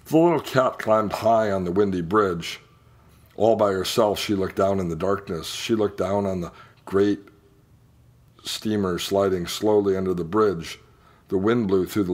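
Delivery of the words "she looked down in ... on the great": ordinary delivery, nothing marked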